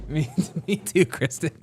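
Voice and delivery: ordinary talk, nothing marked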